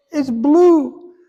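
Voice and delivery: insistent repeat. Retold or imitated. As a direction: push it, she won't be doubted.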